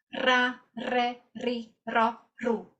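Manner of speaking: "Ra, re, ri, ro, ru" with a trilled r; rolled R. Shown as a demonstration. Teacher drilling students on the Spanish trill. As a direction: trill each R clearly. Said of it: "vibrar un poco más".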